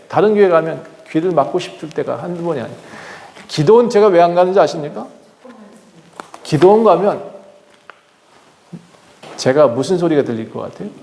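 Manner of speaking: ordinary delivery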